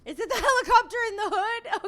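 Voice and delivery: high, loud burst of laughter through the words; laughing in surprise. Real, not acted. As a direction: crack up mid-question at the noise. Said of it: "It, it threw me off".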